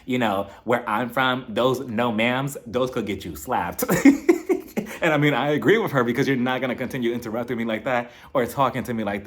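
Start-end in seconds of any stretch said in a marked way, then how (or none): 0.0-3.8 slow, knowing warning; imitating a slow, pointed warning; slow Southern warning. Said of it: "she was like". 3.9-5.0 a loud, high cackle; real amused laugh. Real, not acted. Laughs at her own joke.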